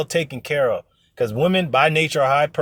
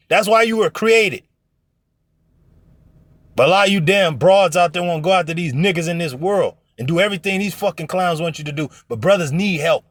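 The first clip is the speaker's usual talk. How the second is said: normal talking voice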